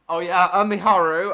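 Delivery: loud call to a friend. Real, not acted. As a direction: call out to get her attention. Calling out to Miharu.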